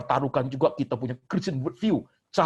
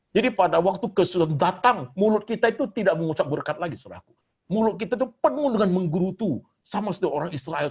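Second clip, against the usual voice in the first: higher and louder, pounding the pulpit with each clause; fiery preaching; 6 semitones higher, 5 dB louder than usual. Acted. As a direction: preach it full force at the congregation. Preaching at full force.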